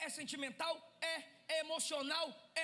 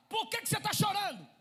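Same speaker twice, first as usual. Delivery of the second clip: higher urgent shout; worried husband; 7 semitones higher, 7 dB louder than usual. Acted. Husband pressing his crying wife, an urgent shout. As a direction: demand it with concern. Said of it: "Olha o que que ele tá dizendo".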